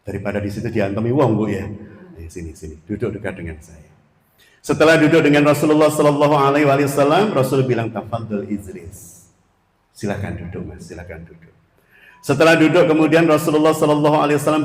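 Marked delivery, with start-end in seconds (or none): 2.2-3.9 softer, tender repeat of the call; hushed, kindly invitation; softer gentle calling. Retold or imitated. Prophet gently calls the youth. 7.9-11.5 a hushed, fatherly please sit; gentle invitation. Retold or imitated. Prophet kindly invites youth to sit.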